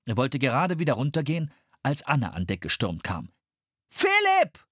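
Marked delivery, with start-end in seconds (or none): 3.9-4.7 much higher, louder cry; screamed name. A narrator's reading. Anne screams for her brother on deck. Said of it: "schrie sie".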